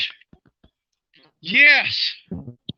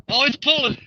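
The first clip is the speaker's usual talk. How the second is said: ordinary delivery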